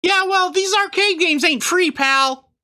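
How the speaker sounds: high squeaky Christmas elf; chirpy elf shopkeeper, way up high. Acted. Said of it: "do I do an elf voice?".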